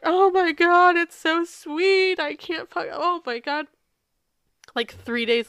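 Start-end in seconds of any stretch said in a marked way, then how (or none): 0.0-3.7 high, slow, crying voice; overwhelmed with tears at how sweet it is; drawn-out, high, weeping words; teary oh my God. Real, not acted. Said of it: "I'm fucking blubbering over here".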